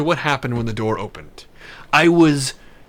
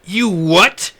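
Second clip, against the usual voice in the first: retold shock; 4 semitones higher, 7 dB louder than usual. Shocked at counterfeit confession.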